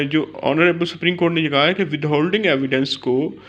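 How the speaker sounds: ordinary talk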